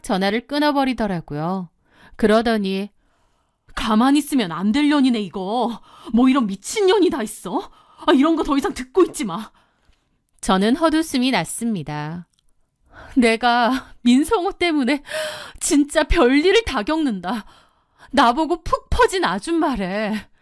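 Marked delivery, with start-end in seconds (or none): 3.7-9.5 fast, indignant sisterly outburst; furious. Acted. Sister telling the wife to stop listening, furious. 13.2-20.3 bitter disbelief. Acted. Wife scoffs bitterly after insult.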